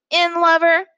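high, loud taunt; taunting yell. Acted. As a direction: yell the insult like a jeering child. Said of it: "he yelled".

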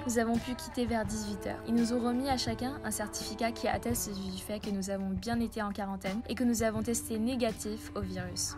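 normal talking voice

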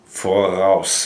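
Voraus as for-OWSS, stress on the second part; German voraus. Shown as a demonstration. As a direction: say Voraus, light r, ending in OWSS. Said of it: "the most difficult word to say is voraus".

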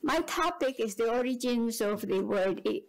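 ordinary talk

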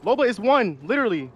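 high excited shouting; combat callout. Real, not acted. Fight callout.

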